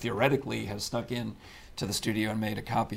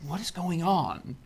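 confused; 8 semitones higher, slower than usual. Retold or imitated. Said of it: "improvisers will be there going".

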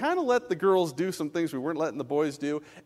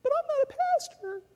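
very high squeaky protest; mock whiny excuse. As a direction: imitate a feeble excuse in falsetto. Voicing a squirming church member's excuse.

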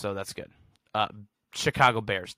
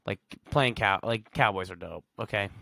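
ordinary delivery

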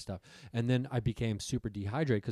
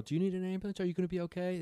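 concerned; 8 semitones higher, faster than usual. Retold or imitated. Megan asks girl.

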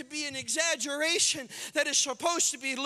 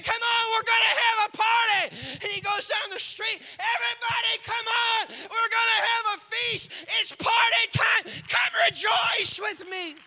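high, loud joyful calling to the neighbours; excited shepherd shout; 7 semitones higher, 6 dB louder than usual. Acted. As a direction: shout the good news down the street.

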